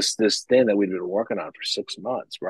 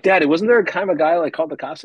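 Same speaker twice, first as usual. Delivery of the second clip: put-on higher, louder child voice asking; imitating his kid; 7 semitones higher, 4 dB louder than usual. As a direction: play the curious child, lightly. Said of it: "they're like".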